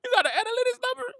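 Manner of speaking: very high, fast teasing; mocking squeal. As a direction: squeal it like a fake expert. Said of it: "Y'all like".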